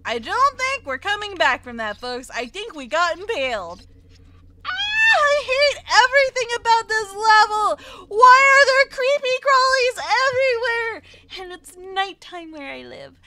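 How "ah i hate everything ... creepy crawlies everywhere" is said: high loud panicked shriek. Real, not acted. Gamer shrieking at the creepy crawlies on the level. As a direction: shriek it out in disgust.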